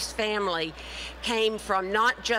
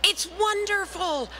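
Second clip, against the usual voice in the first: much higher, gushing with delight; delighted editor; 9 semitones higher than usual. Retold or imitated. Editor gushing to [name] about her title, excited and much higher. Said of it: "And he said".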